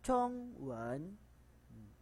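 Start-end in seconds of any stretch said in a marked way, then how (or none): none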